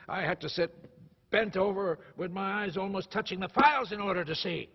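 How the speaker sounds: emphatic, aggrieved recollection; indignant. Acted. Indignant account of childhood toil.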